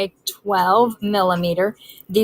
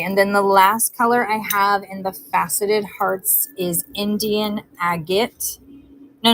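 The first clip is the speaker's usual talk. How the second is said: ordinary talk, nothing marked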